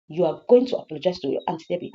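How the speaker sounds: ordinary delivery, nothing marked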